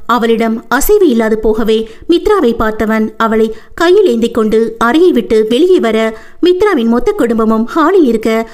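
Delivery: ordinary delivery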